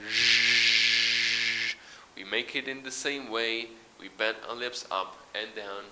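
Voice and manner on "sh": Sh held long and buzzing with voice; voiced zh sound. Shown as a demonstration. Teacher practises the voiced sound with the class, buzzing. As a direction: hum the Sh with your voice. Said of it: "let's practice this sound one more time".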